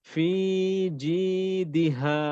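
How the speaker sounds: very slow chanted tilawat; Quran recitation. Shown as a demonstration. Teacher modelling the verse for the student again. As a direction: recite slowly, keeping দি short. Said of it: "teacher recites again".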